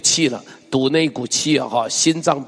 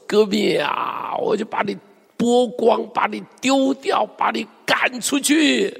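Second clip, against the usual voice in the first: high, emphatic mock threat; fierce threat; 6 semitones higher than usual. Preacher voices threat to cast them out, fierce. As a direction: climb higher with each punishment, dragging the last. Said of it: "前面是说得很凶".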